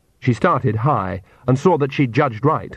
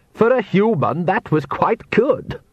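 high, slow, patronising praise; condescending vampire Count; 9 semitones higher, slower than usual. A narrator's reading. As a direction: pat her on the head with words. The Count speaking.

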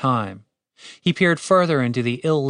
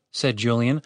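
plain reading voice

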